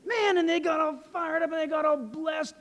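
high, loud, excited storytelling; fired-up telling. Retold or imitated. Preacher tells how the people got blessed, fired up. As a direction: mimic a crowd's fervour while telling it.

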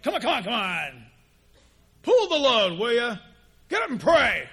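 loud, impatient mock taskmaster; hammed-up scowling God giving orders; stern God. Stern God driving a believer to pray, loud and impatient. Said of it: "got this scowl on his face".

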